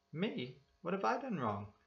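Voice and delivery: innocent passenger. A narrator's reading. Passenger protesting to the cop, high and innocent. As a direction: play the wronged innocent.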